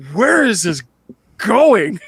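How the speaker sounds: an audience caught off guard; stunned audience. Retold or imitated. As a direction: moviegoers blurting out their confusion. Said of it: "everyone's going".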